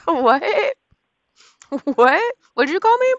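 loud, high, rising outraged questions; indignant disbelief. Real, not acted. Woman just cursed at by a scam caller, indignant disbelief. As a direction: demand it, pitch shooting up.

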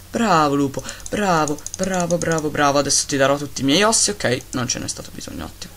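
usual speaking voice

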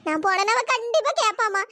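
ordinary talk, nothing marked

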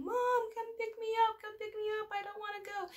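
put-on high-pitched whine of a child calling mom; mock wail, held high like a kid; crying child imitation. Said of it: "she just is screaming, crying".

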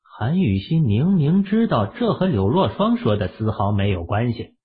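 usual speaking voice

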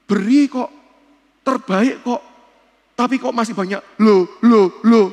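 hammed-up indignant exclamation; mock protest. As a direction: act out the doubter's loud complaint. Mock exclamation of doubters' objection.

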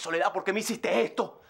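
ordinary talk, nothing marked

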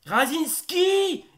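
high, loud, slow stretched name call; drawn-out name shout. Acted. Player name called again.

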